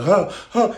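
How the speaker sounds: high, loud huffing breaths; imitated breathless huffs; mock panting. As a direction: pant like he's about to finish. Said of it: "when he's about to".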